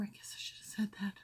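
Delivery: fast sheepish whisper to yourself; whispered aside. Real, not acted. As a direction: hurry it under your breath, sheepish. Self-correction aside after forgetting episode.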